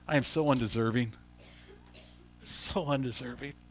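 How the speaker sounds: choked up, barely holding back tears; teary. Real, not acted. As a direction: voice thick with real tears, quiet and low. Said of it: "I'm all teared up and I can't read".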